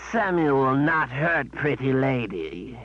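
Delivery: slow, grumbling beast voice; sulky creature denial. Acted. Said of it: "Samuel grumbled".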